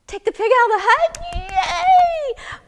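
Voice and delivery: high, loud, rapid question into a cheer; overjoyed. Acted. Man hearing wise woman's welcome advice, elated. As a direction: blurt it excitedly, then squeal with joy.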